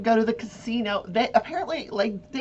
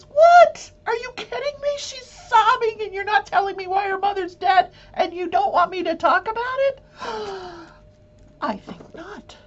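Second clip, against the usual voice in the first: shrieking high, loud disbelief; mock indignant juror shriek; outraged juror; 12 semitones higher, 6 dB louder than usual. Imagined juror outraged at admonition.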